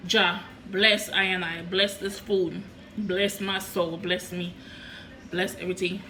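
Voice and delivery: low murmured Rasta blessing; Jamaican accent. Pretend. Mock Rasta blessing over food.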